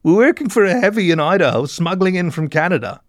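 acted weeping mole; weeping confession. A narrator's reading. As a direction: wail it out, high and pleading. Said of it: "he wept".

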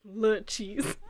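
imitated French accent. Listener echoing the cheese seller, mock French accent. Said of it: "The Fringlish is so good".

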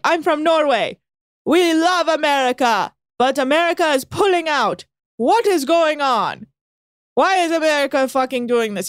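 mock an aggressive yacht owner venting; hammed-up angry neighbour yelling; aggressive Norwegian guy. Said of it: "he comes in so aggressive".

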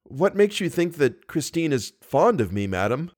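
ordinary talk

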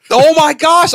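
high, loud gasp of discovery; a sudden eureka moment; stunned realization. Retold or imitated. Man on a lawnmower realizing the answer, high amazed exclaim.

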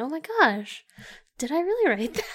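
high, loud mock disbelief; retold shock. Cohost retelling finding her old note, mock shocked. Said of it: "I went".

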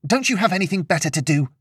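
high, fast, understated shout; exasperated shout. A narrator's reading. Fed-up host to gossiping guest, high exasperated shout. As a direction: snap it out high and quick. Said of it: "Lillian shouted, a bit louder than she had meant to".

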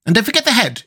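high, urgent reminder; exclaiming. A narrator's reading. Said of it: "exclaimed the Wogglebug".

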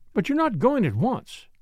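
high-voiced, alarmed protest; dismayed cry. A narrator's reading. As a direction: object as if caught off guard. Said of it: "cried Flora".

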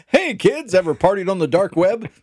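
loud, high huckster pitch; hammed-up ad pitch. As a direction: sell it like a sleazy ad. Mock ad pitch to kids.

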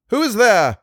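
high, loud challenge; loud cry. A narrator's reading. He challenges the creaking on the stairs, crying loudly. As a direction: cry out loudly at an unseen intruder. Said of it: "he cried loudly".

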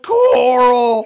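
joking about the meme, anguished Rick wail; Coral meme yell. Retold or imitated.

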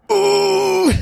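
high hammed-up yelp of pain; overacted high-pitched "Ooh!" cry; Richard Tyson's yelp. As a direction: mimic a cheesy movie cry, way up high. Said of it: "and he just goes".

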